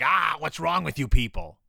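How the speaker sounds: exasperated outburst, imitating an angry teacher; hammed-up scolding; mock angry. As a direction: burst out in mock frustration. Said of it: "like, angrily".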